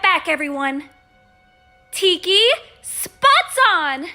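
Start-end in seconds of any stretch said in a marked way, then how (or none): 1.9-4.1 loud, raised transformation cry; heroic call-out. Acted. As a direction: proclaim the magic catchphrase. Heroine calls out transformation phrase.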